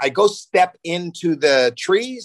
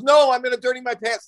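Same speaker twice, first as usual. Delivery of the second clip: put-on higher little girl protest; whiny toddler; 8 semitones higher than usual. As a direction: voice the daughter, pitched up. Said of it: "she goes".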